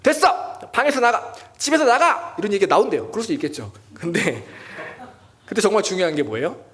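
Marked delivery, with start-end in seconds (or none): 0.0-2.3 shouted high and loud, a hammed-up couple fight; mock angry yell. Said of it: "감정이 격해지면 이런 말이 나온다".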